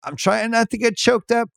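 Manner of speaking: mock choked-up "choked up", voice pushed high; imitating choked-up actor. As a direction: play someone holding back tears, half-joking. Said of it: "great job doing the".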